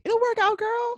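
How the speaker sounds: high sing-song mock comfort; fake reassurance. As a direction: sell the cheerful lie brightly. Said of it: "We were like".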